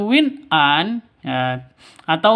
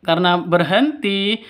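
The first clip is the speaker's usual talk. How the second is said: ordinary talk, nothing marked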